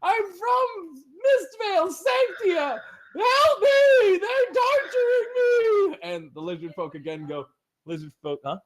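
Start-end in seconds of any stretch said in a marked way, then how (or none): 0.0-6.0 very high, loud, slow wailing cries for help; a child shrieking his name, begging; screaming child. Acted. Tortured boy shouts from inside to the strangers, screaming. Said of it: "You hear more screaming".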